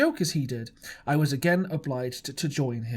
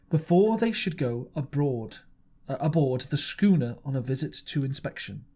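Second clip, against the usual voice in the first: plain reading voice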